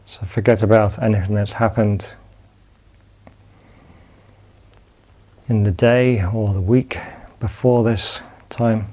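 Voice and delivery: calm, unhurried body-scan guidance with long pauses; guided meditation. Acted. Teacher guiding students lying down through a body scan, calm meditation voice.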